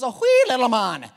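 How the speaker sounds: playful loud Irish lilt; hammed-up Irish voice; Irish accent. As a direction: put on a mock Irish accent. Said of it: "be Irish when I say it".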